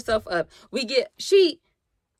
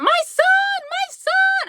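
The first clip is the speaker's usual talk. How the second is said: much higher, louder shriek; mock scream; 4 dB louder, slower than usual. The mother screams for her son, high mock shriek. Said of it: "she started screaming".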